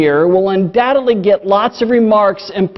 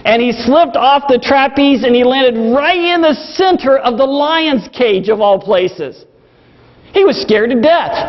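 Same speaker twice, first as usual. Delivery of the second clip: normal talking voice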